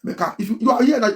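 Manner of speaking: usual speaking voice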